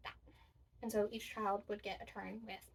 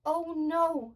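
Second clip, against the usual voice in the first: put-on dismayed child; 4 semitones higher, 10 dB louder than usual. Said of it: "said Anthony".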